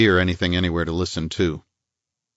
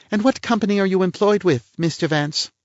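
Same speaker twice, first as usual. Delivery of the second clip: a higher-voiced prim society lady; lady's voice, pitched up and a little pert; voiced woman; 12 semitones higher than usual. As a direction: play the indignant lady of the house. Woman figure's lines.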